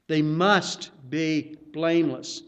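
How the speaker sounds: "must" hit hard, whole line slowed; stress on must. Shown as a demonstration. Preacher to congregation, slowly stressing "must". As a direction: lean heavily on "must" in "They must be blameless". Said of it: "put the emphasis on the word must".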